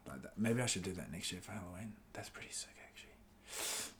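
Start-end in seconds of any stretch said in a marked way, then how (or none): 2.1-3.9 soft unvoiced whisper, drawn out. Real, not acted. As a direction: breathe it like a secret to yourself. Whispered aside to himself.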